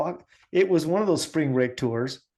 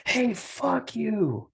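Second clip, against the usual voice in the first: retold drunk taunt; 8 semitones higher, 4 dB quieter, much slower than usual. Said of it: "he turns around and he goes".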